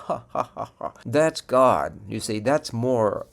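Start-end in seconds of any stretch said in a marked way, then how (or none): 0.0-0.9 hearty belly laugh, mimicking a plump uncle; ho-ho it up like the jolly uncle at Christmas dinner. Preacher imitating a plump uncle laughing at Christmas dinner, jolly chuckle. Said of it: "and he's laughing".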